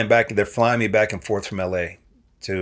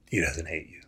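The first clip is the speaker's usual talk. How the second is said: low, soft, reassuring; gentle father; 3 semitones lower, 10 dB quieter than usual. Retold or imitated. Dad calmly reassuring his son, low and soft. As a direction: settle him down like a patient dad. Dad's calm retold reply.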